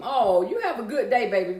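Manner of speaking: ordinary talk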